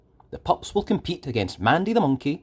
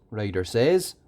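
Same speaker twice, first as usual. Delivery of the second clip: usual speaking voice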